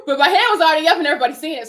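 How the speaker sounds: laughing, fast and loud; giggling at her own panic. Real, not acted. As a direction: rush it out through laughter. Laughing retelling her raised hand.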